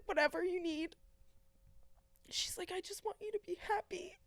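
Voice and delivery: high, tear-choked voice; crying. Real, not acted. Retelling mom's loving reply, crying. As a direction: fight through tears to get it out.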